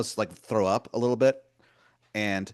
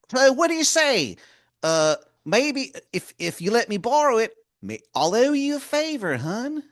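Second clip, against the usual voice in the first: much higher and louder, a sweet-talking wizard calling you hun; Baltimore wizard voice; 7 dB louder than usual. Acted. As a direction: wheedle like a pushy old wizard begging a favor. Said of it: "He goes".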